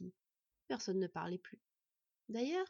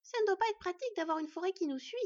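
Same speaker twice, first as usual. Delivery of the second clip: put-on high-pitched child's remark; small, high, sniffy observation; sniffy little girl; 9 semitones higher, 4 dB louder than usual. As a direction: comment in a little fox girl's voice. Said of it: "renifla Sylveg".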